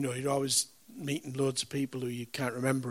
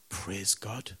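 soft whispered wonder; whispered awe; 5 semitones lower, 7 dB quieter than usual. Retold or imitated. Preacher whispering his own amazed thought. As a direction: breathe it in awe. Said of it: "And I thought".

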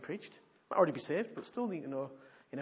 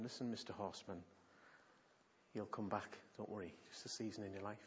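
much softer and lower, gentle; tender reassurance; 6 semitones lower, 11 dB quieter than usual. Retold or imitated. Preacher voices God comforting the broken pastor, soft and low. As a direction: soothe him like a father comforting.

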